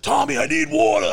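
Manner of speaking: high, loud imitation of a panicking man; panicked cry. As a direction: cry out for water in mock panic. Panicking man cries for water.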